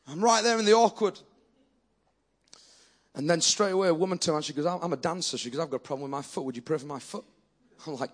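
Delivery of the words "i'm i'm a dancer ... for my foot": soft, lower, hushed voice asking for prayer; a gentle, timid plea; quiet request. Retold or imitated. Dancer quietly asking the preacher to pray for her foot, soft and low.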